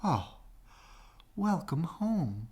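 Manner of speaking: slow, tender welcome; welcoming swan. Acted. Mother swan welcoming the duckling, slow and tender. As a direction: welcome him gently home.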